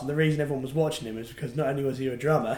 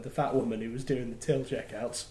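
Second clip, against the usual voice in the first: ordinary talk, nothing marked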